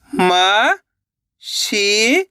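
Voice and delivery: drawn-out syllable by syllable; sounded out slowly. Shown as a demonstration. Teacher sounding out a word for pupils, slowly drawn out. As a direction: stretch each sound for the pupils. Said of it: "एक अक्षर का उच्चारण करके".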